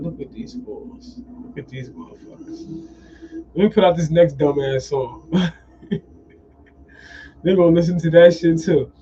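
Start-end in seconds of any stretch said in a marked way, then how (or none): 0.0-2.9 hushed scornful aside. Retold or imitated. Powers that be watching people fight, hushed scorn. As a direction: drop to a murmur, drawing it out.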